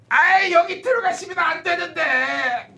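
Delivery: high, slow, pleading doorman voice; a guard shouting after an intruder; strained shout trying to hold him back; flustered doorman. Acted. Someone tries to stop him entering.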